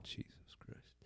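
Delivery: breathy under-the-breath whisper of disbelief. Real, not acted. Friend hearing gossip, whispering in disbelief. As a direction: whisper it, barely voiced, to yourself. Muttered reaction to news.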